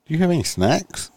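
high, pointed question; retold cop question. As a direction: needle her with a high question.

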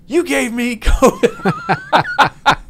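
high, loud, slow angry accusation through laughter. Retold or imitated. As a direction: imitate a pissed friend, laughing. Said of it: "He's so pissed".

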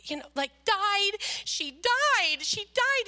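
mock-tearful child, pitch climbing; upset child, building. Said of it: "I'm so emotional".

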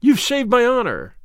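high-pitched, fervent exclamation; grateful cry. A narrator's reading. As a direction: cry it out with overflowing gratitude. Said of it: "he cried".

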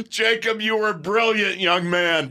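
laughing praise. Real, not acted. Praising producer through laughter.

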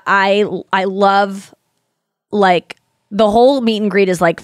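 usual speaking voice

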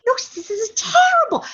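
high, drawn-out dismay; acted outcry of dismay; appalled protest. Acted appalled reaction to her father.

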